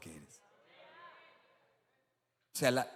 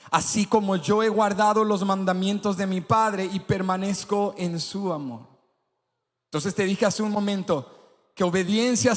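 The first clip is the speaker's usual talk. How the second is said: loud, higher sermon voice; preaching; 7 semitones higher, 8 dB louder than usual. Acted. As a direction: proclaim it to the whole congregation. Preaching at full force.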